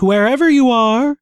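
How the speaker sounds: high, rising, teasing singsong; lilting creepy taunt. A narrator's reading. Villain taunting hidden heroine.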